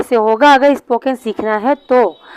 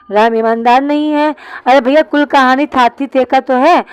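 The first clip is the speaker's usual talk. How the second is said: usual speaking voice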